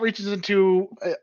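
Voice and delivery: usual speaking voice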